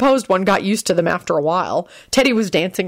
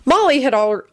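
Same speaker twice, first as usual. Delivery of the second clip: ordinary delivery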